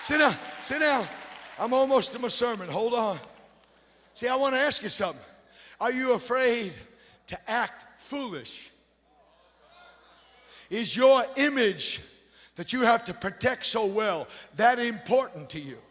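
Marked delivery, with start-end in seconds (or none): none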